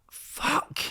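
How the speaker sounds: whispered curse. Retold or imitated. His reaction to the diet.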